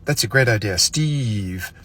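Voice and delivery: "Steve" said slowly with a pause after "idea"; name dragged out. Shown as a demonstration. Complimenting Steve, name said slowly. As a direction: let "Steve" hang, slow and deliberate. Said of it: "But if you say".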